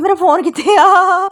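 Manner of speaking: high, loud, slow alarmed cry; retelling a scare when she thought her phone was lost, crying out in alarm; panicked cry. Retold or imitated. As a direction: gasp it out like your phone just vanished. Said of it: "ਮੈਂ ਡਰ ਗਈ".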